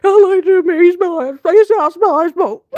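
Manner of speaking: high, loud, breaking with laughter; can barely get the words out for laughing; laughing through it. Real, not acted. Retelling the embarrassing confession to viewers, crying with laughter.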